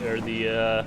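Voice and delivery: ordinary delivery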